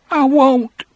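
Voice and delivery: put-on high childish defiance; childish, trembling. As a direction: refuse like a frightened child. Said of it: "in a childish, trembling voice".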